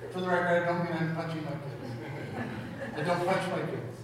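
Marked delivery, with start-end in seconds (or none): none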